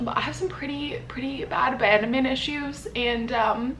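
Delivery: tearful whisper; fighting back tears, barely voiced; crying. Real, not acted. Telling viewers about abandonment, whispering through tears. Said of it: "I'm crying".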